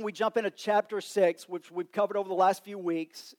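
usual speaking voice

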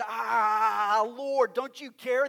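loud, high, exasperated outburst; Martha's loud, exasperated complaint to Jesus; angry complaint. Retold or imitated. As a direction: vent at Jesus, fed up. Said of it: "She's angry".

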